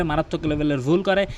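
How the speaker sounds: ordinary talk, nothing marked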